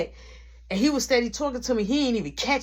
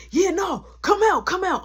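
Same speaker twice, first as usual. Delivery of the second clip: mock calling out; 9 semitones higher, 4 dB louder than usual. Man calling her out of store.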